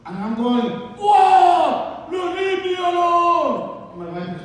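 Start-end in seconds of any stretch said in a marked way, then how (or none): 1.0-3.9 high, drawn-out comic yell; screaming at people. Acted. Grinch driver screaming at honking drivers, high and loud. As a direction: scream back at them, stretching the words. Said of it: "Stop screaming at people".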